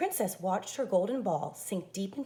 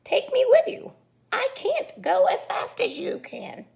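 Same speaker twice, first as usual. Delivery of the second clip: high, loud, then slower frog plea; frog pleading loudly; 11 semitones higher, 10 dB louder than usual. Acted. Frog begs the princess to take him, high loud plea. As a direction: beg her to wait in a shrill little frog voice. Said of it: "cried the frog".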